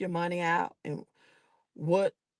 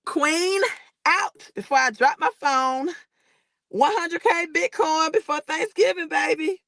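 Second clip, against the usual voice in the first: excited sign-off; 9 semitones higher than usual. Real, not acted. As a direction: sign off still buzzing with excitement. Hyped sign-off after win.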